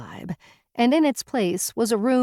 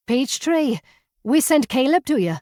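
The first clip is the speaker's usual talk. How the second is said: higher, bright and lively; cheerful; 7 semitones higher, 4 dB louder than usual. Acted. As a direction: say it with bouncy cheer. Bubbly woman explains cheerfully.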